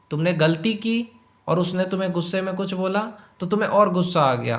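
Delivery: ordinary delivery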